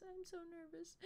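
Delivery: high anxious murmur; nervous squeak. Real, not acted. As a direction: squeak it out, tense.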